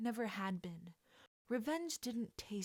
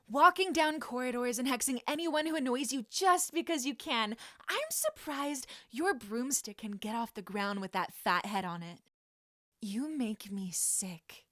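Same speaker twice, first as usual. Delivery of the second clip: furious tirade; 3 semitones higher, 7 dB louder than usual. Acted.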